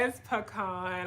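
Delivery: pecan slow and soft, puh-KAHN. Shown as a demonstration. Correcting her co-host, pecan said puh-KAHN. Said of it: "I'm not gonna argue with, with the guests".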